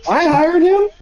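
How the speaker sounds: very high-pitched, incredulous protest; mock outrage. Host playing outraged boss at his hire, high-pitched disbelief.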